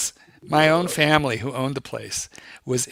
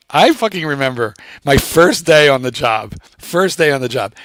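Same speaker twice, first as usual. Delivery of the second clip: ordinary delivery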